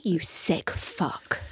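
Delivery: slow hushed joking jab; mock whispered insult. Teasing host about recording while she pees, slow hushed mock insult.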